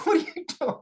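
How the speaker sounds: high, startled retelling of a shocked question; surprised what. Retold or imitated. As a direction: ask it as if someone just jumped on your back. Startled by friend jumping on back.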